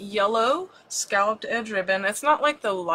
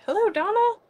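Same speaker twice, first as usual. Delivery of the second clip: excited greeting; 12 semitones higher, 3 dB louder than usual. Real, not acted. Greeting arriving viewer.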